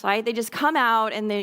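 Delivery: usual speaking voice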